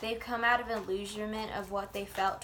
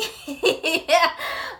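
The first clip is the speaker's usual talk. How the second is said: high loud burst of laughter; 8 semitones higher, 11 dB louder than usual. Real, not acted. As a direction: cackle out loud. Laughing at own joke.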